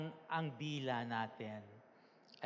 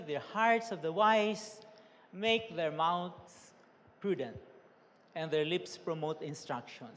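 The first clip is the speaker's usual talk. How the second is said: slow, lifted reading of the verse; scripture reading; 2 semitones higher, 4 dB louder, slower than usual. Acted. Pastor reading Proverbs aloud to the congregation, slow and solemn. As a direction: read the verse out slowly and gravely.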